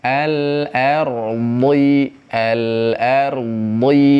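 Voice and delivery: "al-ardi" drawn out very slowly, heavy r; chanted Quran reading; slow tajwid recitation. Shown as a demonstration. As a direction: stretch every sound of "al-ardi". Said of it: "akan berbunyi seperti itu".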